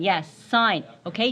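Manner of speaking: ordinary talk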